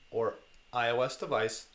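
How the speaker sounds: normal talking voice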